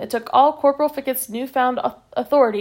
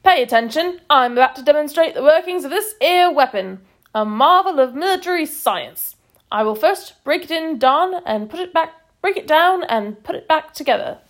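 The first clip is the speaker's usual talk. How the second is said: loud, higher drill-corporal bark; higher and louder throughout; army corporal; 5 semitones higher, 4 dB louder than usual. A narrator's reading. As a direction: lecture the troops like a cockney corporal. Corporal lecturing the troops.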